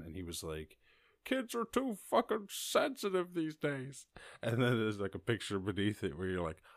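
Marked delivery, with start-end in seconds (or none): 1.2-4.1 much higher mock old-man grumble; mock grumpy old man. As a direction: lampoon a cranky old man. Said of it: "a picture of an old man".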